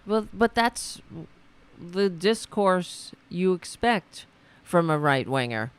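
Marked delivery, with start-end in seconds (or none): none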